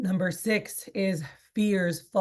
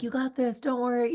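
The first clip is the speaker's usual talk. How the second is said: reassuring encouragement; 6 semitones higher than usual. Retold or imitated. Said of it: "they would be like".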